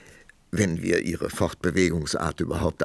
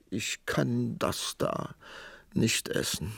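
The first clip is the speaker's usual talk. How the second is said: high, slow, whimpering voice; plaintive; 6 semitones higher, 4 dB quieter, slower than usual. A narrator's reading. Queen over her honey bread, plaintive. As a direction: whimper it slowly, fading out. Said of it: "sah kläglich auf den Honigtopf".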